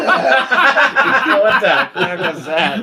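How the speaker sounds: laughing. Real, not acted. Laughing at the beatbox.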